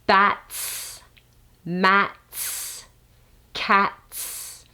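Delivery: "bats", "mats", "cats" with the final "ts" drawn out into a hiss; stretched final ts. Shown as a demonstration. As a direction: lengthen the air after the t in "cats".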